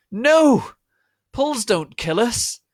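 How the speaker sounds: high, loud desperate plea; pleading cry. Acted. Vampire begging Voldemort to spare them, shrill loud plea. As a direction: beg for your life at full volume. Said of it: "pleaded Vampire".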